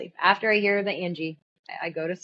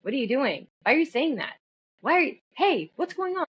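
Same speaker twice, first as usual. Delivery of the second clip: escalating questions; 8 semitones higher than usual. Retold or imitated. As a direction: pitch climbing with each retold question. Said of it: "Escalated like that".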